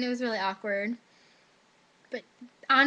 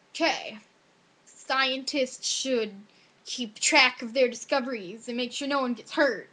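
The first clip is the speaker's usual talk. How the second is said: slow start, words drawn out; mock essay composing; slower than usual. Student recites their hasty test essay, slow mock composing. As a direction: stretch it out as if writing while thinking.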